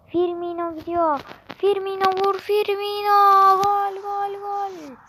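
rising commentator cry, stretched goals; excited football commentator. Pretend. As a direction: call the goal like a TV commentator. Mobile football game goal commentary.

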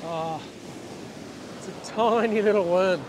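usual speaking voice

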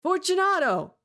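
high, loud call into the dark. Acted. Montresor calling into the sealed niche, a high loud call. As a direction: call his name into the niche. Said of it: "I called again".

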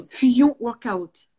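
Fusion Workout said with a self-mocking English accent; exaggerated English accent on a brand name. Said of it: "Mon super accent anglais".

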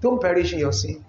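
low, soft, tender appeal; gentle pleading. Acted. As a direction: plead softly, as if coaxing someone home. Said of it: "You plead, you beg. You say".

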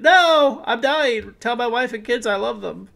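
high, loud dying soldier cry; wounded soldier gasping. Pretend. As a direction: gasp out last words, mock tragic. Voicing his dying crawler unit.

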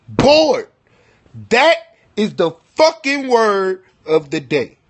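loud, high proclamation of the word; loud announcement. Acted. To the camera, declaring the word of the day, loud and high. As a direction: announce it like a game show host, loud.

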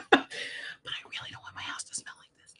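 giggling hushed whisper; whispered confession with a giggle; laughing whisper. Real, not acted. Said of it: "I have to whisper it".

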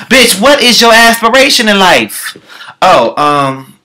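ordinary delivery

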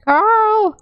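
high hammed-up call of Carl; mock shocked cry. Finding Carl dead again, mock alarm. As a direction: cry his name in fake alarm.

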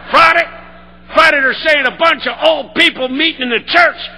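loud, building call and response; preaching at full force. Shown as a demonstration. Speaker preaching to the crowd, booming and building. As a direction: drive it home, shouting the climax. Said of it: "let's get into it".

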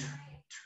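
a bare, voiceless "t"; isolated t sound. Shown as a demonstration. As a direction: release just the consonant "t", very softly. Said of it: "Termina como en un t". (sonido "t" solo, sin voz)

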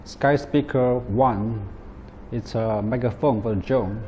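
ordinary talk

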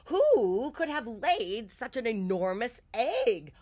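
astonished storybook hen; squawking hen. A narrator's reading. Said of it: "Martha squawked".

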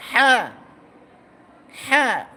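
হ্যাঁ pushed out loud and firm from the throat; forceful throat letter. Shown as a demonstration. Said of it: "শক্ত করে বলো".

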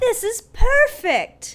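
high, slow, gushing delight; excited. Retold or imitated. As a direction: squeal it like a dream come true. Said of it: "I'm like".

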